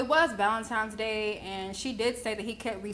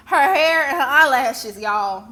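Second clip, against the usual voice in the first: much louder, higher, incredulous mockery; loud mocking quote; 3 semitones higher, 11 dB louder, slower than usual. Telling viewers all he complained about, loud mocking quote. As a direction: belt it out to ridicule his complaint.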